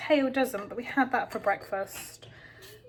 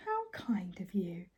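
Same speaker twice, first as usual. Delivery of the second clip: sarcastic whisper; 3 semitones lower, 8 dB quieter, slower than usual. Real, not acted. Sarcastic aside to the baby.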